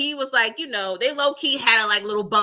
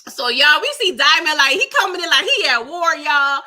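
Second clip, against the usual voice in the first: louder and higher, hyped up; excited; 4 semitones higher, 8 dB louder than usual. Real, not acted. Telling viewers Diamond rushes in like he's at war, hyped. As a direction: hype up the viewers about the rescue. Excitedly describing the rescue scene.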